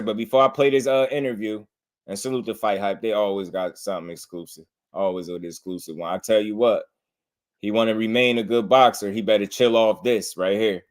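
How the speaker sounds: usual speaking voice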